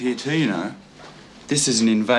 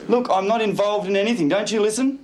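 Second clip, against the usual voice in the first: raised pitch, exasperated denial; indignant protest; 9 semitones higher than usual. Acted. Accused client to probation officer, indignant protest. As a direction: protest as if never listened to.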